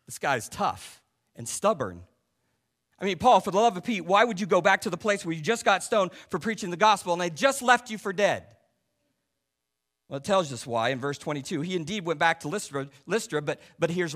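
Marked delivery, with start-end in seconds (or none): 3.0-8.4 higher and louder, fast disbelief; exasperated at Paul's stubbornness. Real, not acted. Exasperated question to Paul.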